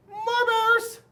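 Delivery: put-on high, loud kids' shout; high-pitched holler of boys and girls; children yelling. A narrator's reading. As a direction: yell it like an eager crowd of kids. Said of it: "sounded just like boys and girls".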